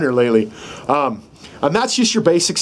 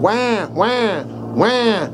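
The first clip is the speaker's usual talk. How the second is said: higher imitation of a crying baby; mock baby cry; 5 semitones higher, much slower than usual. Shown as a demonstration. Instructor demonstrates the sound with his mouth, mock cry. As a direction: wail it out gently. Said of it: "when you make that sound".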